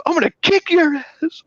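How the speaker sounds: high mock tough-guy threat; threatening guy. Retold driver threatening him, high mock menace. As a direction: ham up the bully. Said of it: "He goes".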